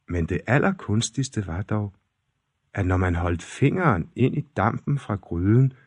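usual speaking voice

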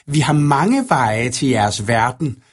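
slow, drawn-out menacing creature voice; sinister dark-maker. A narrator's reading. Second dark figure threatens Anemone in a nightmare, sinister. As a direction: stretch out the threat, villain-like.

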